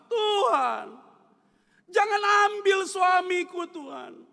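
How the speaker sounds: high, loud pleading cry to God; imitated tearful prayer. Wife praying for her husband's life, acted tearful pleading. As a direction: plead loudly, stretching the words. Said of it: "dia berdoa sambil nangis".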